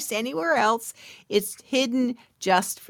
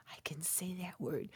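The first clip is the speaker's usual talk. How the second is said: much softer unvoiced whisper to herself; whispered aside; 3 semitones lower, 19 dB quieter than usual. Real, not acted. Stumbling over a word, whispering an aside to herself. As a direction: whisper a quick self-correction under your breath.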